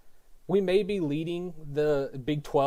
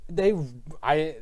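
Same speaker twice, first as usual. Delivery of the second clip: usual speaking voice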